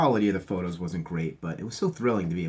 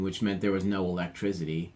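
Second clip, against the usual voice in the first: usual speaking voice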